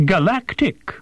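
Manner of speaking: high, precise voice of a small winged creature; formal, formal alien creature, pitched high. A narrator's reading. Creature Prath speaks.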